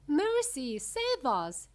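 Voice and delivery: high, loud start, easing off; storybook mother's alarmed cry; yell. A narrator's reading. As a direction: yell a plea in horror. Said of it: "yelled Missus Beauregard".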